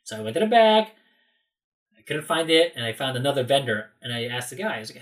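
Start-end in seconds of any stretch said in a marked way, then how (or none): none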